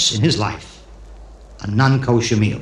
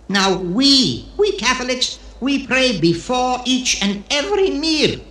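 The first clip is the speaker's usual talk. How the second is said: lightly voiced pious guest, pitched higher; 7 semitones higher than usual. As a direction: lecture your host in a raised, earnest voice.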